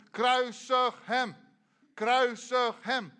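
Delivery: loud, high, slow mob chant; crowd shouting. Acted. Crowd before Pilatus's palace demands crucifixion, shouting. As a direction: shout it like an angry crowd, drawing out each word. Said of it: "begonnen allemaal te schreeuwen".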